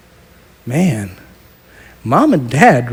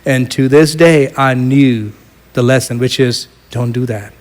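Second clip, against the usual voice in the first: ordinary talk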